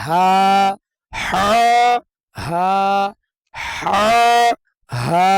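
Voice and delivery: হা with air pushed out, then হা said plainly; breathy versus plain হা. Shown as a demonstration. Teacher demonstrating breath on the two হা letters. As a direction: alternate the airy হা and the plain হা. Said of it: "কোনো বাতাস বের হবে না".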